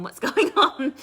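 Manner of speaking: breaks into a high laugh mid-question; laughing through it. Retold or imitated. Mocking her own pestering of her sister, laughing. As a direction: laugh at your own nagging.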